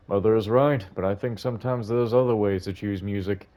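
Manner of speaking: put-on old man. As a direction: speak as a gentle elderly gentleman.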